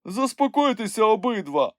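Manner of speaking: high-pitched firm interjection; intervening. A narrator's reading. Nyukhmumryk steps into the quarrel, firm interruption. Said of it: "втрутився у сварку Нюхмумрик".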